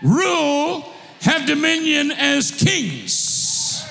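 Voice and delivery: rousing sermon delivery, calls ringing out; fired-up preacher calling his church to rise; full-force preaching. Acted. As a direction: drive it home to the congregation. Preacher exhorting congregation.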